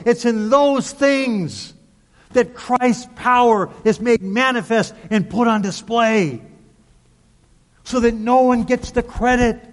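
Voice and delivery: high, insistent sermon crescendo; full-on preaching. Real, not acted. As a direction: drive each repeated phrase home to the congregation. Preacher drives home the point.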